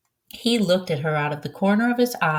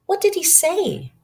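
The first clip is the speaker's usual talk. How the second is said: a hint of an eager boy, higher; light boyish question, pitched up; lightly voiced boy; 12 semitones higher than usual. Narrator voices boy Alex.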